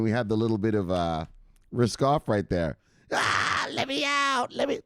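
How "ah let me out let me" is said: high, frantic cry to be let out; mock panicked scream. Imitating a panicked bus passenger screaming. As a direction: wail like a scared passenger. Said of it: "see the weekend screaming in the back".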